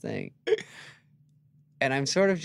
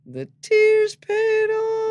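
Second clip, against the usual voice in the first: very slow, high, loud mock; high, stretched-out jab; mocking remark; 8 dB louder, much slower than usual. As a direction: drag it out to mock him. Mocking Norm's tears.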